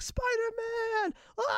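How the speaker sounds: very high, drawn-out excited scream; kids screaming. Retold or imitated. Kids greeting Spider-Man as he arrives, imitated high scream. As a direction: imitate children shrieking at their hero. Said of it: "just, like, screaming".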